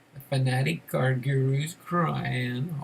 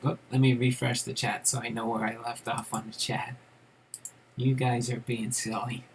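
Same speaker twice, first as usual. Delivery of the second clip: ordinary talk, nothing marked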